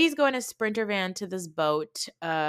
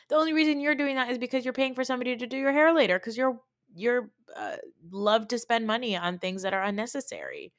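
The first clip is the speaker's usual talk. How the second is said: fast, higher, heated rant at Jen; exasperated rant; 6 semitones higher than usual. Real, not acted. Talking straight to Jen, a fast exasperated rant. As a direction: rattle it off in disbelief.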